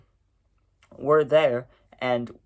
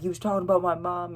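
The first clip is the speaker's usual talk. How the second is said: put-on higher-pitched indignant kid complaint; mild mock outrage; feisty kid protest; 6 semitones higher, faster than usual. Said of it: "I was getting all feisty and all mad".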